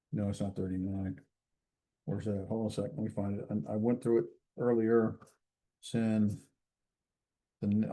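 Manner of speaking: low, soft aside while searching; talking to oneself. Real, not acted. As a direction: think aloud quietly, hunting for the page. Searching the book, talking to self.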